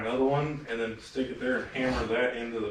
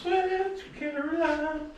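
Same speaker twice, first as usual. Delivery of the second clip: very high playful call; high sing-song call; much slower than usual. Pretend.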